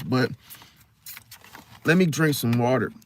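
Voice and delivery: usual speaking voice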